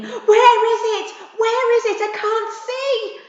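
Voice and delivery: high loud frantic scream; panicked screaming. Acted. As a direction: shriek blindly in the storm. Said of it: "Zack was screaming".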